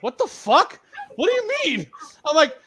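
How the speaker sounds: a laughing, disbelieving yelp; baffled outburst. Retold or imitated. To Kenny at the party, a high laughing baffled outburst. Retold confused reaction to Kenny.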